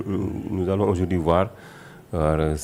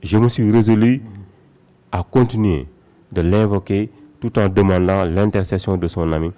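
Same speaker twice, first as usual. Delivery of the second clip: loud, raised declamatory verse reading; poem recitation; 3 semitones higher, 8 dB louder than usual. A narrator's reading. Guest reciting the Cheikh's praise poem for listeners, declaimed. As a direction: proclaim each line of devotion with force, pausing between phrases.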